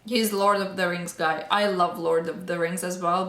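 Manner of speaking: ordinary talk, nothing marked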